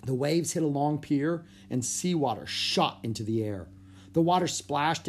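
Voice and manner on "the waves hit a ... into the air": plain reading voice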